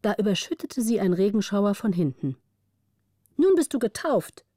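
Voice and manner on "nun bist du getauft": put-on high, gleeful teasing girl; playful call. Margareta teases Gritta after splashing her, high and gleeful. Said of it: "rief Margareta".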